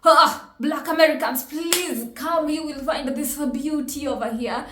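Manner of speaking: loud, eager shout of welcome; excited welcome. Retold or imitated. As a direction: call them over with open arms. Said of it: "my first thought would have been".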